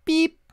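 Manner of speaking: high, sharp horn "beep"; imitated car horn. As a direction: toot it like a horn.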